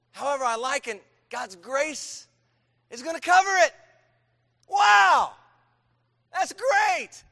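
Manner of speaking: rising, then high and loud mock delight; voicing the listener's foolish question; mock eager sinner. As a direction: play the naive listener thrilled at a loophole. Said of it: "What's Paul say to that?".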